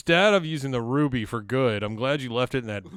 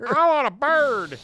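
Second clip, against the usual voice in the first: put-on high, slow whiny girlfriend; whiny Lana voice; 11 semitones higher, 5 dB louder than usual. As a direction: whine like a spoiled child. Voicing Lana childishly.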